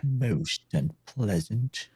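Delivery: slow, drawn-out mimicry of a polite Englishman. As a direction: echo the film's Englishman, stretching the words. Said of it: "had a way of saying things that just sounded".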